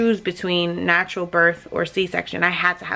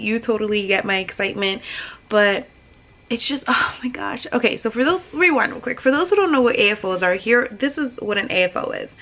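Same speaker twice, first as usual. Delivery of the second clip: ordinary talk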